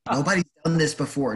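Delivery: usual speaking voice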